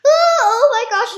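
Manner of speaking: loud, high cry of alarm; startled. Real, not acted. Player suddenly spotting an Enderman, real startled outburst. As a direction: yelp it out in sudden shock.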